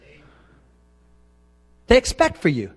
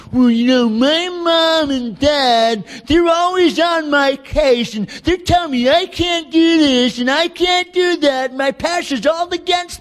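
high, drawn-out mocking whine; whining complainer; 11 semitones higher, 5 dB louder, slower than usual. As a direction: whine it like a put-upon youngster.

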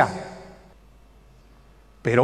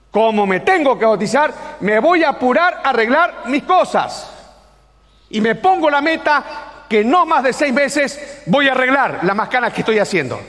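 higher, insistent declaration; emphatic resolve; 5 semitones higher than usual. Retold or imitated. Boy who wants to please God repeats his vow, raised emphatic resolve. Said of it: "qué dice el chico que quiere agradar a Dios".

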